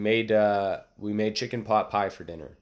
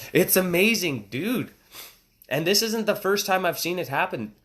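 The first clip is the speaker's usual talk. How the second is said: usual speaking voice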